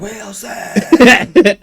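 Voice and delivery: loud, high, wailing call; castaway calling out. Acted. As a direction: holler for a lost friend at sea, hammed-up. Echoing the castaway's cry for Wilson.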